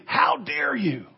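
high, drawn-out cry of outrage; indignant outrage. Acted. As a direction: thunder it as a stern rebuke. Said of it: "Paul's force".

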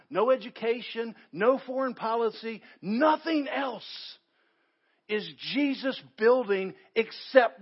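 sermon crescendo; preaching build. Acted.

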